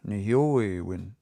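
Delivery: nehioewin, stressed on the middle o, slowly; stress moved further along. Shown as a demonstration. Teacher adds a syllable to nehioewin, stress shifted along. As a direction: slowly model nehioewin, peaking on the o after hi. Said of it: "add one more syllable on the end".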